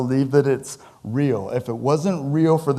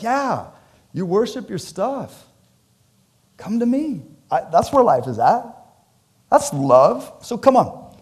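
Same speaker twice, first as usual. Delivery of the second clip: mock Jesus inviting; 7 semitones higher than usual. Jesus voiced inviting rich ruler.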